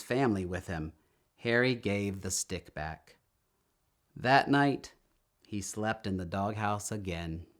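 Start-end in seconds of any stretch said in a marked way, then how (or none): none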